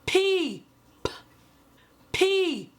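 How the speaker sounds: "p" as a breathy puff; puff of air. Shown as a demonstration. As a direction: pop the "p" out with air. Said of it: "like a puff of air".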